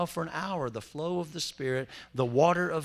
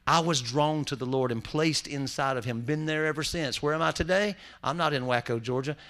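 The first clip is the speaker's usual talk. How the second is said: ordinary talk, nothing marked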